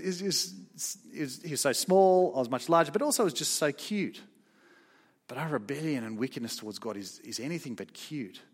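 ordinary talk

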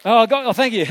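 real relief at remembering; relieved laugh. Real, not acted. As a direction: laugh as the words come back to you. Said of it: "Just need that next word".

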